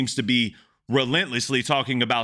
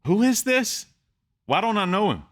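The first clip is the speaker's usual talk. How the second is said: stunned disbelief; 10 semitones higher than usual. Real, not acted. Reactor hearing an unknown rapper for the first time, amazed. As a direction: blurt it out like you can't believe your ears.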